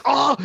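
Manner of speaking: high, loud mock yelp; sudden high "oh" of pain, acted. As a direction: cry out as if sharply pinched. Acts out being pinched.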